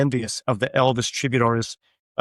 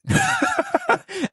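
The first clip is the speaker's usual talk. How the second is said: high-pitched laugh. Real, not acted. Laughing at crying on stage.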